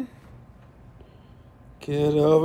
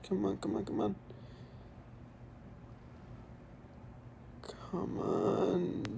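psyching myself up; 18 dB quieter than usual. Real, not acted. Psyching self up on climb.

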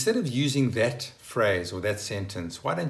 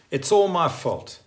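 slow, raised, emphatic admission of blame; deeply sincere; 6 semitones higher, 5 dB louder, slower than usual. Shown as a demonstration. Taking all the blame upon yourself, deeply sincere. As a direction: plead it slowly from the depths of sincerity. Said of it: "drawing deep from the well of sincerity".

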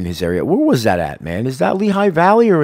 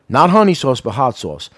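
ordinary talk

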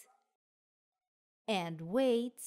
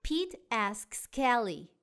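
ordinary delivery

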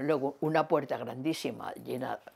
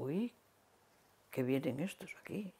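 softer, uneasy murmur to herself; half-voiced worry as the guards come in; retold fearful aside; 7 dB quieter than usual. (murmullo asustado, más suave)

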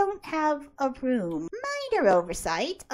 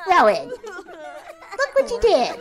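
loud half-laughing scold, slow; mock outrage through a laugh; 4 semitones higher, 5 dB louder, slower than usual. Real, not acted. Player scolding Rowan for the broken dollhouse, loud and laughing.

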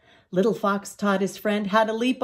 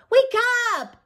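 urgent warning cry; urgent call; 4 dB louder than usual. A narrator's reading. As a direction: call out to rouse a sleeping friend. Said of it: "he called to the Little Badger".

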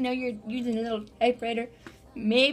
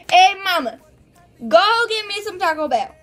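loud, higher call to mom; loud demand; 5 semitones higher, 7 dB louder than usual. Pretend. Kid yelling to mama for Taco Bell, loud and high. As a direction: holler it across the house. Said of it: "You could be like".

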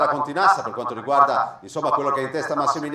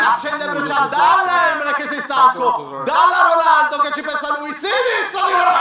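breathless italian goal commentary; 8 semitones higher, 4 dB louder than usual. Acted. Goal commentary replayed, rising to shout.

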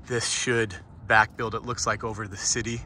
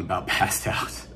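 breathless laugh; 4 semitones lower than usual. Real, not acted. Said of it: "I need to hit the gym".

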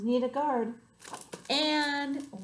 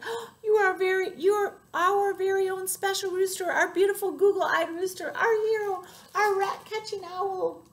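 high, loud admiring hens; gushing praise; 9 semitones higher, 5 dB louder than usual. A narrator's reading. Hens praise their rat-catching owl, high adoring gush. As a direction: gush over your hero in a high voice. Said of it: "saying nice things".

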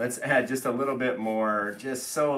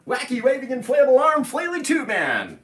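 hyped announcer; 9 semitones higher, 4 dB louder than usual. Acted. Repeating the catchphrase on donations.

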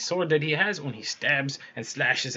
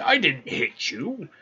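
indignant denial; 4 semitones higher, 4 dB louder, slower than usual. Acted. Accused giant denies hitting the other.

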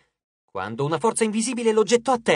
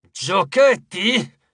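scornful echo; 6 dB louder, much slower than usual. A narrator's reading. Cupid scoffs at Nico's word.

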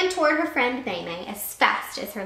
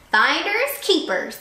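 slow, gloating fisherman's claim; higher, slow gloating grab; exclaiming fisherman; 7 semitones higher, 4 dB louder, slower than usual. A narrator's reading. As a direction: claim the find with glee. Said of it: "he exclaimed".

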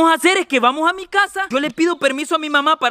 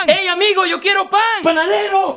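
higher-pitched hollering to a vendor; calling out; 4 semitones higher than usual. Acted. Customer hails the panadero for bread, calling out. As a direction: call after someone passing by.

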